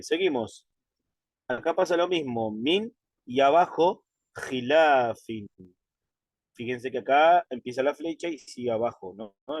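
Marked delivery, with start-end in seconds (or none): 4.3-5.5 "khilafin" drawn out slowly and carefully; careful, stretched reading of "khilafin"; slow Arabic demo. Shown as a demonstration. Teacher modelling the word for the class, slow. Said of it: "entonces solo acentúa".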